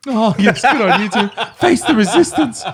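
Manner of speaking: high, loud laughing pep talk; mock cheerleading. Real, not acted. To Tim going home to write, high loud hype.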